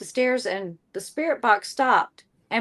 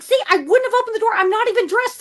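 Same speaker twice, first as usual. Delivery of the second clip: high, quick flustered protest; flustered insisting; 11 semitones higher, 5 dB louder than usual. Retold or imitated. [name] proving she would not open the door, flustered. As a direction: prove your point in a fluster. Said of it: "I'm like".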